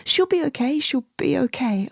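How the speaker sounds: high, loud repeated reassurance; urgent mantra. Retold or imitated. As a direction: say it like you need to believe it. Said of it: "a mantra".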